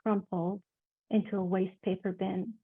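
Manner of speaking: expressive literary reading, swelling to loud climaxes; dramatic reading. Acted. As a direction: read the passage with rising drama. Author reads her novel excerpt.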